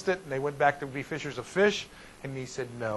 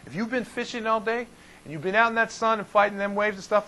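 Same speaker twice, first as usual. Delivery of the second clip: usual speaking voice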